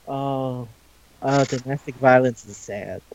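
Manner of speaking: low, soft deadpan; deadpan reaction. Retold or imitated. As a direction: imitate a subdued, let-down reaction.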